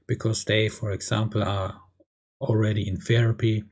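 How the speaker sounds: ordinary delivery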